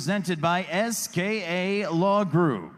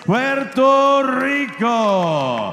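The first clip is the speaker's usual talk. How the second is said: higher, booming, drawn-out name call; lucha libre announcer; 5 semitones higher, 6 dB louder, slower than usual. Acted. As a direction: shout the name to the rafters. Announcer introduces Puerto Rican boxer.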